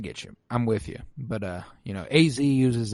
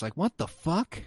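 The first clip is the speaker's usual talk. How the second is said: normal talking voice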